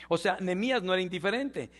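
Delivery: normal talking voice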